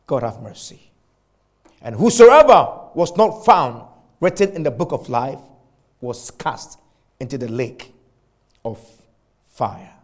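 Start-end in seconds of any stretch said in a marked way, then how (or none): 1.8-9.9 preacher letting the verse die away into hush; scripture reading fading. Acted. Reading ends falling away.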